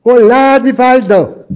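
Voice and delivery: a woman shouting a glad hello; joyful shout. Retold or imitated. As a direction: call out happily to greet him. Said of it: "E ela gritou assim".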